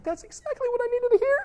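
moved listener. Retold or imitated. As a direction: play the grateful, teary friend. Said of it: "and they're just like".

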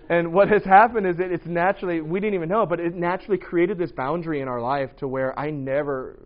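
ordinary delivery

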